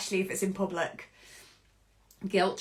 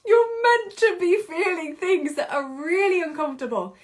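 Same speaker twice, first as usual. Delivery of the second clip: laughing; 7 semitones higher, 5 dB louder than usual. Real, not acted. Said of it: "laugh cry".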